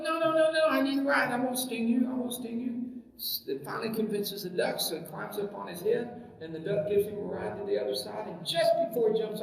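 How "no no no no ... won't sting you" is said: high, coaxing storybook scorpion; reassuring scorpion. Acted. Scorpion begs the duck for a ride, high and reassuring. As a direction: pitch it up, quick denials from a pleading scorpion. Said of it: "He goes".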